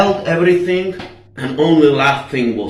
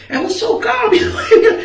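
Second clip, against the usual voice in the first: real laughter through high-pitched words; laughing. Real, not acted. Storyteller amused at his own calm, laughing. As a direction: crack up while telling it.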